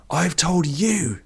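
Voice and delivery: high, slow strained shout; a craving man yelling, pitched high and dragged out; shouted back. Acted. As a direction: throw it back at him, voice cracking high. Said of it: "Vish shouted back".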